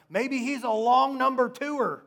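high, loud comic taunt; mocking. Preacher as Elijah jeering at the false god's prophets, loudly mocking. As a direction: jeer it out, voice pitched right up. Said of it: "you see how he's just mocking them".

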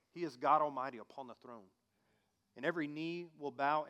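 ordinary delivery

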